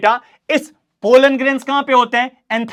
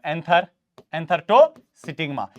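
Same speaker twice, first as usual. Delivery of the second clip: lower, soft dictation pace with pauses; slow dictation; 5 semitones lower, 4 dB quieter, much slower than usual. Shown as a demonstration. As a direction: spell out a definition for notes. Teacher dictates definition slowly. (धीमी आवाज़ में लिखवाना)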